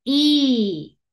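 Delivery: drawn-out "I" as eee; long E sound. Shown as a demonstration. Teacher naming the long sound, stretched "I". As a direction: hold "I" as a long ee, falling. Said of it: "Sonido largo".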